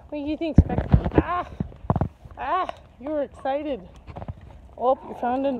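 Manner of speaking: ordinary talk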